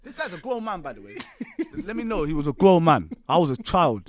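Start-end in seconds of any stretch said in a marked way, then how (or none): 1.8-4.0 much louder, insisting; emphatic. Real, not acted. Stressing to his friend that he was only a child, loud and emphatic. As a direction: drive the point home hard.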